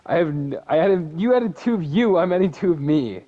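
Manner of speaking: loud, high laughing protest; excited protest. Real, not acted. Mock outrage at friend's additions.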